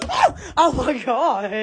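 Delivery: ordinary delivery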